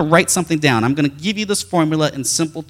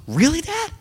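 put-on much higher, delighted child's thanks; excited kid; 11 semitones higher than usual. Son Radley thrilled about ice cream, excited child's voice. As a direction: gush like a thrilled little boy. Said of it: "his first response was".

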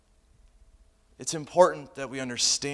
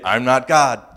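mock retort; 3 semitones higher, 11 dB louder, slower than usual. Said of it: "you could say".

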